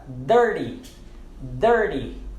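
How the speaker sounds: "dirty" with a D sound after the R. Shown as a demonstration. Teacher gives the example word, "dirty" with T said as D. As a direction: say "dirty" with its T softened to D. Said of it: "vai também ter o som de D".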